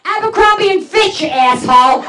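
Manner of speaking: high then loud put-down; niece's bratty correction, loud; snarky niece. Retold or imitated. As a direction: snap the name then the insult. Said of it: "my niece goes".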